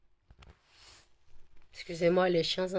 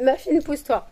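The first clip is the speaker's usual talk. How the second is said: playful shooing; 8 semitones higher, 5 dB louder than usual. Real, not acted. Pushing the dog away.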